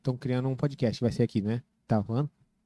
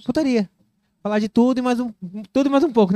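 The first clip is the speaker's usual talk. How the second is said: high, loud, eager pitch; excited; 7 semitones higher, 5 dB louder, faster than usual. Real, not acted. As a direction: sell it with excitement.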